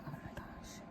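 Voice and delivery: breathless whispered oh my gosh. Real, not acted. Whispering to viewers about breakfast pizza, hushed and longing. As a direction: whisper it like a secret craving.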